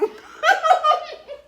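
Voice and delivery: loud high-pitched burst of laughter. Real, not acted. Embarrassed about eating on camera.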